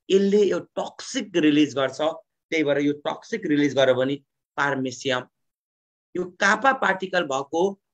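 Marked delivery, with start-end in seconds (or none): none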